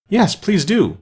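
high, eager group entreaty; joining in chorus. A narrator's reading. Others call to departing mouse, chiming in eagerly. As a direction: chime in, pitched well up. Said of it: "the others all joined in chorus".

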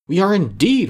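a touch of joyful relief; glad agreement. Acted. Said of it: "responded the educated insect".

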